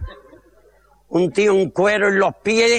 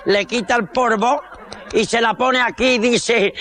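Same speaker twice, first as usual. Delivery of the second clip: usual speaking voice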